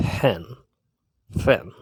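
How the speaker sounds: "ফ্যান" twice, the p close to an f; English f sound. Shown as a demonstration. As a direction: breathe the start of each "ফ্যান". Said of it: "প্রনাউন্সিয়েশনটা হবে অনেকটা এরকম".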